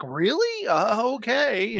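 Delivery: high questioning "really", then drawn-out okay; hammed-up dejected acceptance; mock disbelief. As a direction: imitate someone reluctantly taking no for an answer. Acting out the refused man's reply.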